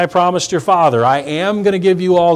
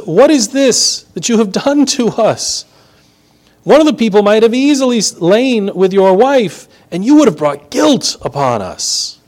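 indignant rebuke; 7 semitones higher than usual. Acted. Abimelech rebukes Isaac.

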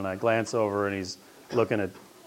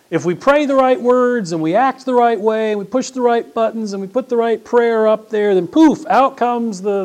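much higher and louder, driving hard; fiery preaching; 11 semitones higher, 8 dB louder than usual. Acted. Pastor warning the congregation, fiery sermon build. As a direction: hammer the point home.

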